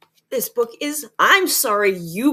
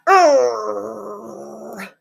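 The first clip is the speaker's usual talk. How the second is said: loud, drawn-out "urgh"; frustrated growl; 6 dB louder than usual. Acted. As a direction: groan loudly in acted frustration. Jack frustrated while writing his apology.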